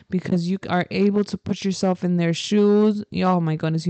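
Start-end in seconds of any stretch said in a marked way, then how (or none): none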